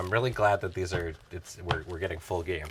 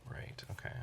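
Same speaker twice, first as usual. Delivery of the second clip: whispering; 15 dB quieter than usual. Real, not acted. Muttering to himself mid-turn.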